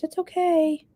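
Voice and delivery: high, gentle mock reassurance; lightly voiced soothing husband; a hint of a gentle husband forgiving; imitating husband. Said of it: "And he goes".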